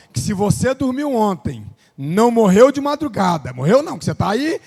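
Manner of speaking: loud, slowing sermon climax; full force preaching. Acted. Preacher telling the church God gave them another day, in a loud slow climax. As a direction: build to a ringing close.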